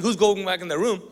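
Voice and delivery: ordinary talk, nothing marked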